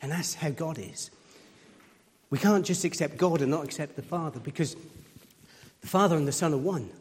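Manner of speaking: ordinary talk, nothing marked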